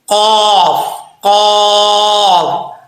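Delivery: full heavy कॉफ from the throat; heavy qaf. Shown as a demonstration. Teacher models the correct heavy qaf. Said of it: "कोशिश करनी है मखरज से निकालें".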